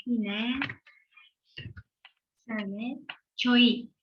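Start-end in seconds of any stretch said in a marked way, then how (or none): 3.4-3.9 choi said as cho-ee, a bit louder; syllable demo. Shown as a demonstration. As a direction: model choi clearly. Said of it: "choi diye okumamız gerekiyor".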